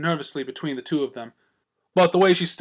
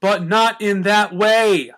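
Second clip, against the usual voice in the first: slow, louder, each word spelled out; exasperated; 5 semitones higher, 7 dB louder, much slower than usual. A narrator's reading. Twilight insisting to Rarity, slow and emphatic. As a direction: drag it out in exasperation.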